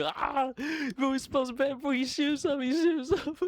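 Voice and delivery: imitating boys shrieking at the door; mock kid screaming. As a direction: squeal it like a mocking ten-year-old. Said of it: "start screaming".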